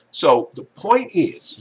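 a slow, loud, emphatic build into the key point; slow emphasis. Real, not acted. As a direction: lean hard into the point. Emphatic turn to main point.

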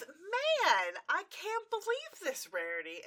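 high, loud, mock-annoyed build-up; fake exasperation. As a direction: sound about to scold. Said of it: "and Twilight goes".

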